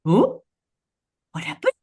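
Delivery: a light take on waking up confused; puzzled surprise. Acted. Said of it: "she looks out the window and she goes".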